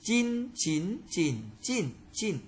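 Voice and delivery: "Chin, chín, chỉnh, chỉnh, chỉnh" through the tones, starting high and level; high, rising, dipping, falling takes of "Chin, chín, chỉnh, chỉnh, chỉnh"; tone drill. Shown as a demonstration. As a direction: recite "Chin, chín, chỉnh, chỉnh, chỉnh" changing only the pitch contour. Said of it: "phần luyện tập về thanh điệu".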